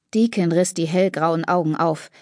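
ordinary delivery

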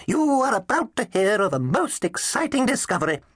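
put-on high twittery little old dinosaur voice; twittery old professor. A narrator's reading. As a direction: chirp it out like a tiny old scholar. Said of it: "twittery old creature".

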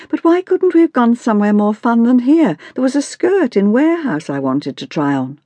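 put-on high-pitched teenage protest; complaining teenage daughter. A narrator's reading. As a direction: push the complaint up high, fed up.

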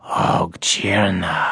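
slow, low, hushed creature voice full of wonder; a small creature murmuring in amazement; awed whisper. Acted. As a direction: breathe the word out in awe. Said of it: "The whisper seemed filled with wonder".

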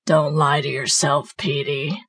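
low guttural demon; hideous guttural voice. A narrator's reading. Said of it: "now in a hideous guttural voice".

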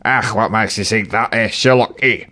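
a Scottish tramp sneering back. Acted. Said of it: "The first was Scottish".

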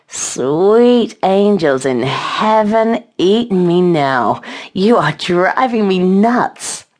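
slow, drawn-out wail; shrieked. A narrator's reading. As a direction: cry it out slowly, stretching each word. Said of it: "Chase shrieked".

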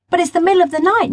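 high, fast, surprised outburst; exclaiming. A narrator's reading. Girl amazed to find a boy out at night, exclaiming. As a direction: blurt it out in disbelief. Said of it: "Nyla exclaimed".

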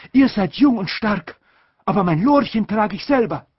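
put-on an aunt bossing the children; aunt's voice. A narrator's reading. Aunt telling the children to carry her luggage, high and fussy.

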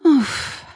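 high, exhaled "ouf"; sudden puff of relief; relieved sigh. A narrator's reading. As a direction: let out a big sigh. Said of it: "soupira-t-elle".

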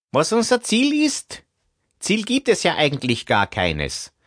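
high, thin man's voice; character voice. Acted. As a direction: play a shrugging group member. Albert character in meeting.

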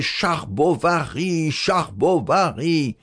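scandé lentement et plus haut, en se moquant; moquerie répétée. A narrator's reading. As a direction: imite la classe qui raille le nom. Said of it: "on répétait". (la classe répète le nom en se moquant, lentement)

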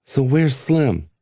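put-on young Length. A narrator's reading. Length asks the hidden voice about Slim, slow and hopeful. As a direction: draw the question out, hoping.

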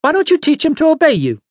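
high, quick scolding question; scolding old lady. A narrator's reading.

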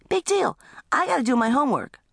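high-pitched bratty retort; dismissive little brother. Acted. Little brother answering teenager, high dismissive retort. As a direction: brush it off like a little kid. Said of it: "Little brother".

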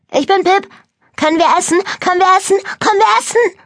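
put-on very high, loud little-kid voice; littlest boy's singsong. A narrator's reading. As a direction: chant it eagerly, climbing with each repeat. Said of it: "sang der Kleinste".

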